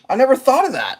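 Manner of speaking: high, loud mock innocence; clueless reply. Woman admits she never thought of cutting it, mock clueless.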